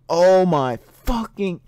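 usual speaking voice

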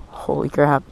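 ordinary delivery

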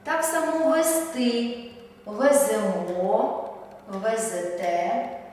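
вести, веземо, везете drawn out slowly, the last syllable stressed; teacher drills the correct stress, slow and careful; slow final stress. Shown as a demonstration. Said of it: "наголошуємо останній склад".

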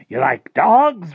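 high, loud, drawn-out pitch; excited offer. Acted. Rictus conjuring pups for Harvey, excited offer. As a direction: sell it big, stretching the words.

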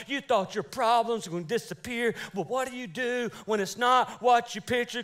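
ordinary delivery

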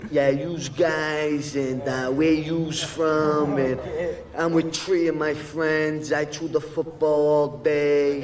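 put-on slow, drawn-out Boston North End accent; thick North End accent. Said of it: "you start giving it the accent".

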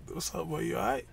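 lightly imitated accent, softly spoken; Gigs. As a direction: toss off a quiet greeting in character. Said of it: "I'm liking your Gigs accent".

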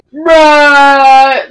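one word held out loudly, level pitch; long loud bruh. Retold or imitated. Kid replying to the pizza guy, long loud bruh. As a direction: drag it out as a loud mock comeback. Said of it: "It's like".

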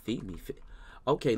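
usual speaking voice

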